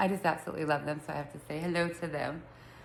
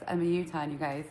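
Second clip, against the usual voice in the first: usual speaking voice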